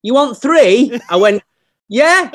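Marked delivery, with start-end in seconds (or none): 0.0-1.0 loud, high checking question; bar staff mishearing. Retold or imitated. Bar staff checking a skint actor's order, raised voice. 1.9-2.3 high, loud gleeful yes; eager mock yes. Skint actor accepting three drinks, high and eager.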